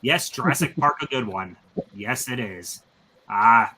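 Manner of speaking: ordinary talk, nothing marked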